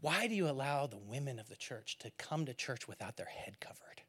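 whispered scandalized question; mock whisper. Pastor whispering the emailer's outrageous complaint to the ladies. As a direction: hush it like a shocking secret. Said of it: "brace yourself, ladies".

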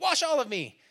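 high, loud outburst; hammed-up eager demand, swinging to the other extreme; Peter overreacting. Disciple begs Jesus to wash him, overreacting. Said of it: "Peter freaks out".

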